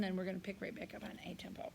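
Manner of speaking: quick hushed whisper; rushed whispered aside. Real, not acted. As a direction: whisper it fast under your breath. Choir director whispering aside.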